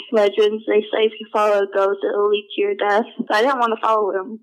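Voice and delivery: usual speaking voice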